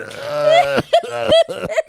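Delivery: high, hammed-up sobbing groan; mock crying wail. Wife in an acted revenge fantasy, comic wailing. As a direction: wail wordlessly, half laughing.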